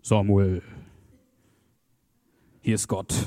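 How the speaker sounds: low, booming mock voice of God; hammed-up deep heavenly voice. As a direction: intone it deep, like God calling from above. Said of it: "die Stimme aus dem Himmel".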